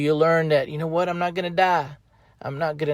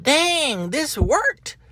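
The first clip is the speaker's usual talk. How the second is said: delighted surprise; 10 semitones higher, much slower than usual. Retold or imitated. Retold surprise at success.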